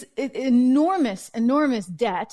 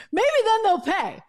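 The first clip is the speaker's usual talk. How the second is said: laughing; 8 semitones higher than usual. Real, not acted. Laughs at NATO's expense.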